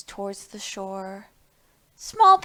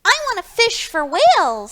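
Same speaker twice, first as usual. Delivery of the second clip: high, loud piglet voice; Small Pig wanting whales, high and eager; eager little pig; 9 semitones higher, 7 dB louder than usual. Acted. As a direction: squeal it like an excited small pig.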